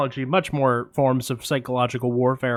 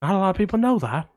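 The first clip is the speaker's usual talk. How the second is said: Michael Caine impression; 4 semitones higher, faster than usual. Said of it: "Jesus, Michael Caine".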